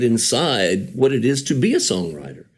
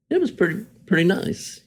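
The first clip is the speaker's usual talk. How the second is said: higher, understated mock shrug; unimpressed Montanan; 8 semitones higher than usual. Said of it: "the Big Sky Country songwriter will go".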